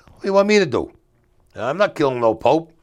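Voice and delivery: high, loud, shocked refusal; retold indignant outburst. Narrator refusing his cousin's murder plan, appalled loud outburst. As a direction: blurt it out, appalled.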